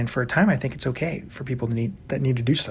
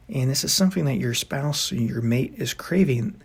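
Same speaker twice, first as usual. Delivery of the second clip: ordinary talk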